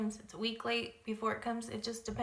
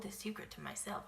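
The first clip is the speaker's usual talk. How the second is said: whispered secret; 9 dB quieter than usual. Retold or imitated. Confiding she can't keep secret.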